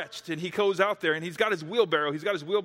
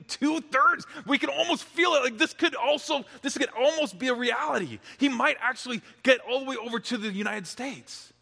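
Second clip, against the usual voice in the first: rising excitement; 7 semitones higher than usual. A narrator's reading. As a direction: let the hope swell up. Crowd's excitement building.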